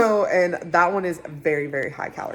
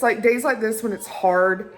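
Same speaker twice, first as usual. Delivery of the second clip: ordinary delivery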